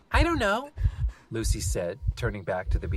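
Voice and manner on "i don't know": put-on child voice. Lucy child voice.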